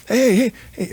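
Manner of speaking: high-pitched, animated mock scolding; animated Monty Kiffin. Old coach chewing out his assistant, high animated scolding. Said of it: "Monty was always animated".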